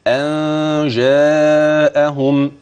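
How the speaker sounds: أن جاءهم very slow with the ا of جاءهم drawn long; slow teaching recitation. Shown as a demonstration. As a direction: recite أن جاءهم slowly for her to repeat. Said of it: "قولي ورايا كده". (تلاوة أن جاءهم ببطء للترديد)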